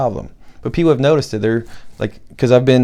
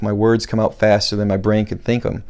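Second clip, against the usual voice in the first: ordinary talk, nothing marked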